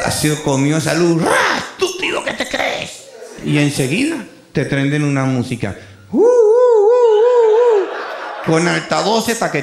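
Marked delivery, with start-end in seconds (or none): 1.3-3.0 sudden high scolding yell; wife's mock scolding. Wife yells at driver. 6.1-8.5 high, loud siren wail; siren imitation.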